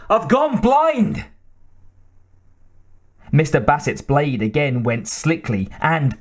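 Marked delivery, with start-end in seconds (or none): 0.1-1.2 high, drawn-out cry of alarm. A narrator's reading. MacLeod with wig over his eyes, crying out. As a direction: wail it slowly, pitch well up. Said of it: "he cried".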